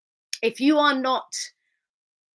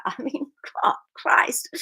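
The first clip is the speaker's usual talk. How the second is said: breathy whispered aside; whispering; 4 semitones higher than usual. Real, not acted. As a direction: whisper it under a laugh. Whispered aside after laughing.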